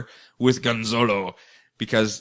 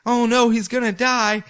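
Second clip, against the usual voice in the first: mock panic; 11 semitones higher, 4 dB louder than usual. Man falling from tower.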